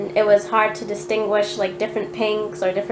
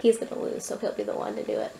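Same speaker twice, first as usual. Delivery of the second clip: whisper; 9 dB quieter, faster than usual. Real, not acted. Teasing aside, whispered to camera.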